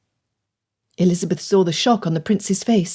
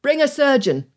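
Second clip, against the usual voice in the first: shout; 5 semitones higher than usual. A narrator's reading. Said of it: "Croft shouted".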